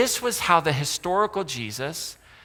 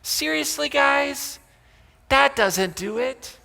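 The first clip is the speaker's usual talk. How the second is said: exasperated disbelief; 10 semitones higher, slower than usual. Pretend. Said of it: "And he says".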